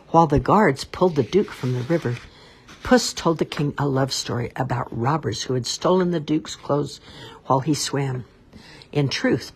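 ordinary delivery, nothing marked

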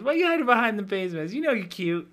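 high, loud, quick flirty impression; hammed-up coy compliment, high and loud; mock flirty hairdresser.

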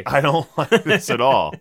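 laughing through the words; half laughing, half groaning. Real, not acted. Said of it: "closing your eyes right now 'cause it's so painful".

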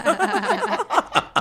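high bright laugh; burst of laughter. Real, not acted. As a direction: laugh out loud at the joke. Laughing at a shout-out.

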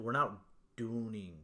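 normal talking voice

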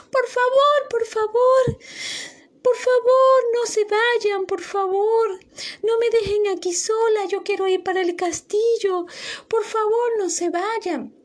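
súplica aguda y temerosa; triste y asustada. Acted. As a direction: rogando a los guardias que no se vayan. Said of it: "Sofía tenía mucho miedo y estaba muy triste". (Sofía suplica a los guardias que no la dejen, voz aguda y triste)

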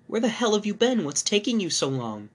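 high, loud angry demand; voiced wife scolding on the phone. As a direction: snap at him down the phone.

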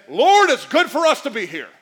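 loud, high, eager Peter bursting in; eager loud blurt. Acted. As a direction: blurt it out, too excited to wait.

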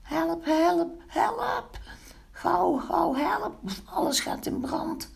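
very high, thin alarm calls; panicked cry for help. A narrator's reading. Pinkeltje cries for help as fire spreads, high panicked wail.

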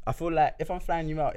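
ordinary talk

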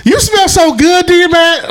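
high mock-girl voice. Mocking a girl hugging him, high girly coo.

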